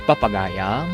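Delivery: usual speaking voice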